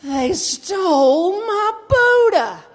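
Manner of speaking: high, loud, very drawn-out cry of outrage; outraged. Retold or imitated. Discovering the stolen Buddha in front of her nephews, outraged slow wail. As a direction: wail it out slowly, aghast. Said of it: "And I'm like".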